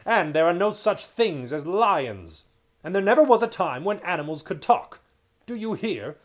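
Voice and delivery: loud, raging scold; furious king. Acted. King Miraz furiously forbidding Caspian's stories. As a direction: pound every word at the boy.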